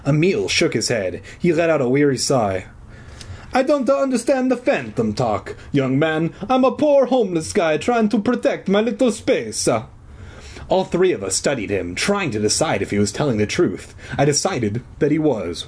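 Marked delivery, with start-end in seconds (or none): 3.5-9.7 high-pitched hammed-up Italian; Italian accent. A narrator's reading. Phantom explaining himself to the kids, Italian accent. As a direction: plead your case in stage-Italian. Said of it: "his hoarse Italian voice".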